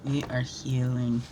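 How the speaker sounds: low, slow, measured statement; calm affirmation. Real, not acted. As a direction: settle into it slowly and sincerely. Same affirmation repeated calmly.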